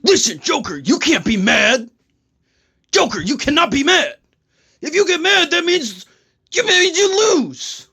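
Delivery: high, strained mock superhero voice; Batman. Superhero scolds his foe for being mad, high strained caricature. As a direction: plead sternly in a pushed-up hero caricature. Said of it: "No, Joker!".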